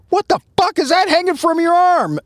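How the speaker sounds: high, startled mock cry; shocked outburst. Chief spotting snake on suspect's arm, shocked high cry. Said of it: "he goes".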